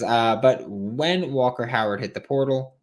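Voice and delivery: ordinary talk, nothing marked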